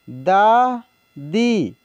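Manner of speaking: दादी drawn out very slowly, sound by sound; slow dictation of दादी; slow spelled-out reading. Shown as a demonstration. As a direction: stretch दादी for the learners. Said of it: "teacher reads word slowly".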